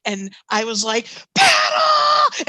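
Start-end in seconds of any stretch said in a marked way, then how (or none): 1.3-2.5 high, loud screamed command; mock-terrified shriek to the crew; panicked scream. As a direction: scream it like bloody murder, retold. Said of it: "we just screamed bloody murder".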